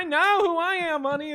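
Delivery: mock her put-on Western accent; stupid Western accent. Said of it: "in this stupid Western accent".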